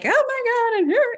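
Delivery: high, loud mock panic; mind drama. Imitating her own spiralling mind, mock panic. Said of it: "the mind drama".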